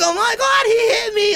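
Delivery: very high mock shriek; hammed-up wail of a girl crying foul; imitating hysterical girl. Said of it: "like that".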